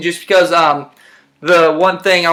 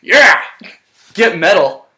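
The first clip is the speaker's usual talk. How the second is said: mock pumped-up self; 6 semitones higher than usual. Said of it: "I was like".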